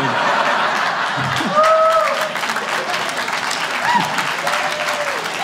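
high, loud wheezing laugh; cracking up hard; big laugh. Real, not acted. Comic cracking up recalling a wild debate season, loud laugh.

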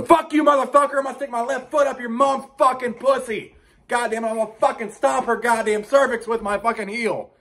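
mock furious kid gamer screaming; kid screaming at game. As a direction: rage at the screen like a furious kid. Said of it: "yell at and get angry".

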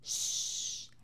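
whispered shh of the owl; owl shush. Shown as a demonstration. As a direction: hush like an owl. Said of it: "la lechuza hace".

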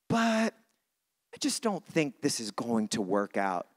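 usual speaking voice

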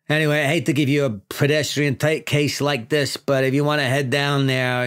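lightly voiced precinct boss, apologetic; mild New York boss voice; police chief. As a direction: hand out a dull case.

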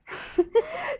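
high burst of laughter. Real, not acted. Laughs at own line.

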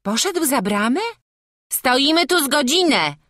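high, loud, lively peasant voices calling; village women searching. Acted. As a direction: hunt for the missing boy, calling around. Wedding guests search for child.

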